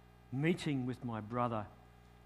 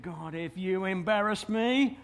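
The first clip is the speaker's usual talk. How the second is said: desperate vow; 7 semitones higher, 8 dB louder than usual. Retold or imitated. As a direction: bargain hard with God, rising to a shout. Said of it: "I said".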